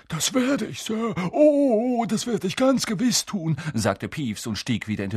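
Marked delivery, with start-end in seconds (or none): 0.1-3.6 high, eager-to-please whine; fawning and cowed, very high voice; grovelling poltergeist. A narrator's reading. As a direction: promise with servile over-eagerness. Poltergeist grovels to the supposed baron.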